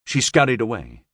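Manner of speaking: usual speaking voice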